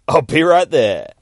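keen, booming reply; eager agreement. Acted. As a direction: jump at the chance loudly. Deadpool eager at word illegal.

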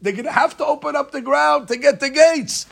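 high, loud eager insight; lightly voiced excitement; sudden realization. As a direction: Asaph struck by a bright idea. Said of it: "so he said".

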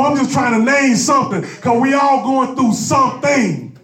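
high, shouted pleading lament; desperate cry to God. Acted. Preacher voicing a believer crying out to God, shouting.

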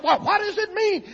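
high, loud imitation of an irate husband; angry demand. Husband questioning his frightened wife, imitated angry shout. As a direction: throw the question back hard.

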